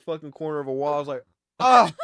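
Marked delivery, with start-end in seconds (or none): 1.6-1.9 short, loud, high cry; hammed-up "ah" of pain; mock pain yell. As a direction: reenact yelling on impact. Reenacts hitting the wall.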